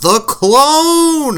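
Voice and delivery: loud, high mock yell, drawn out; yelled exclamation. Villains yelling after the runaway clone, loud drawn-out yell. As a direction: holler it like villains giving chase. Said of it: "they just yell out".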